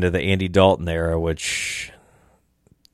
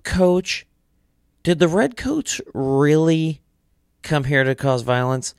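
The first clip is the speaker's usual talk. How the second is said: put-on whiny kid voice; 10 semitones higher, slower than usual. Kid pestering coach with questions.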